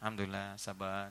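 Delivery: soft, low, barely voiced patience; mother answering husband's anger with soft patience; quiet patient mother. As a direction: swallow it and murmur sabar to yourself. Mother voiced calmly enduring.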